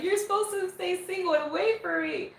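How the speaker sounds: high, whiny put-on fan voice; hammed-up clingy fan; mock possessive fan. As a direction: plead it high, as a deluded fan would. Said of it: "not gonna be like".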